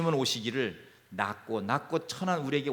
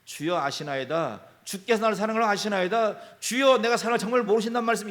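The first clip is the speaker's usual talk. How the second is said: builds higher and louder to an earnest plea; Peter's pleading answer; 6 semitones higher, 6 dB louder than usual. Retold or imitated. Peter answering Jesus, pleading ever louder and higher. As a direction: plead your love, rising with each line. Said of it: "대답이".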